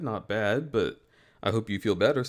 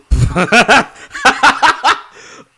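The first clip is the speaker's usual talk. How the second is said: high burst of laughter; 14 semitones higher, 14 dB louder than usual. Real, not acted. Cracking up on stream.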